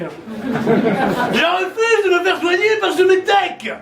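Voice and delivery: hammed-up bigoted old politician; Le Pen impression. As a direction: drag it out with contempt. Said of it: "Le Pen".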